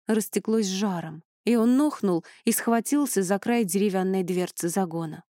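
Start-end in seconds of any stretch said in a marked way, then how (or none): none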